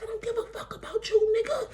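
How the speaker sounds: high-pitched mock woman's retort; imitating angry woman. Woman brushing off the man texting her, mock-angry high voice. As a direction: do her dismissive comeback way up high.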